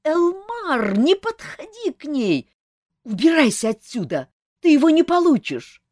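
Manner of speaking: high, loud shrieking nymph; piercing scream. A narrator's reading. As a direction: scream it at him, shrill and desperate. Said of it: "пронзительный крик нимфы".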